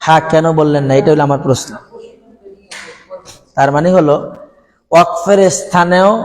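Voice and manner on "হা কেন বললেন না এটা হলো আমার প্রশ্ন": ordinary delivery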